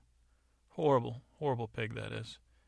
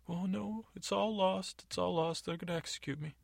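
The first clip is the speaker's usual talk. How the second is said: much higher, whiny and pleading; the jailed captain pleading with Agatha, high and whiny; whimpering dolt of a guard; 9 semitones higher than usual. Acted. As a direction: play a blubbering coward begging the lady. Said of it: "he's kind of weeping in his cell".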